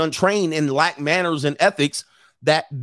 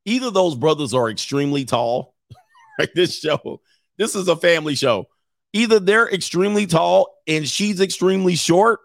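usual speaking voice

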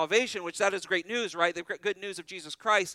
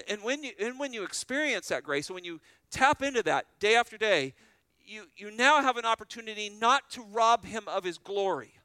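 building preaching; 5 semitones higher, 5 dB louder than usual. Acted. Preacher rising in intensity.

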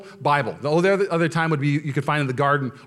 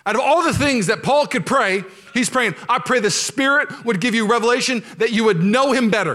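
high, loud fervent preaching; preaching at full force; 8 semitones higher, 4 dB louder than usual. Acted. Pastor rousing the church about forgiving Christians, fervent. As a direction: drive it home to the congregation.

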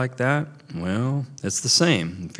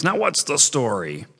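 exclaiming; 7 semitones higher than usual. A narrator's reading. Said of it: "He exclaims".

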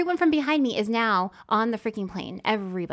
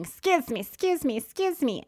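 higher, hurried excuse me; imitating frazzled woman; 6 semitones higher than usual. Frazzled passenger stumbling to her seat, mock hurried. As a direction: push past apologizing.

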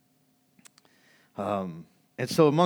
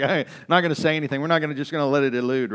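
laughing mock disciple; 5 semitones higher, 9 dB louder, faster than usual. Disciples deciding to stay silent.

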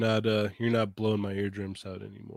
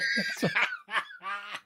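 high laugh. Real, not acted. Laughing at robot voice.

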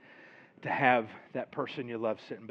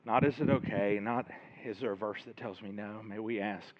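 closing prayer; 2 semitones lower than usual. Real, not acted. Pastor praying over the church, softening earnest prayer.

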